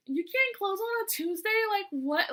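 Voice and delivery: mock-outraged, high and slow; hammed-up boss annoyance; imitating mad manager. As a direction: manager angry about Tuesday. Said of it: "getting so mad".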